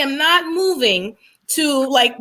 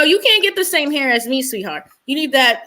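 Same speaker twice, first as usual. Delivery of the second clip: ordinary delivery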